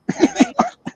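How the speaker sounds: high, soft laugh. Real, not acted. Man laughing at the unwashed penis joke, high soft laugh. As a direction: let out a quiet, high-pitched laugh.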